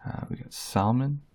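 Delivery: ordinary talk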